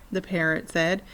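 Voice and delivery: usual speaking voice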